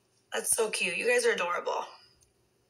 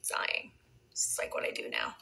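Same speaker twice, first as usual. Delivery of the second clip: whispered through leftover laughter, much lower; giggly whisper; 8 dB quieter than usual. Real, not acted. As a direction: confide it in a hushed giggle. Laughing at her own blunder.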